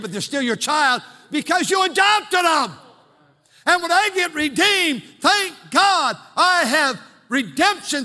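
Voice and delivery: high, loud, ringing preacher's cry; shouting, half-sung preaching; full-force preaching. Acted. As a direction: preach it out at the top of the voice.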